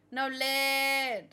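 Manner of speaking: mock anguish, one long sustained "lead"; mock dramatic cry. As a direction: stretch "lead" into a hammed-up wail. Meme repeat parodying the drawn-out yell.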